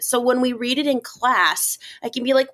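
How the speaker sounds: normal talking voice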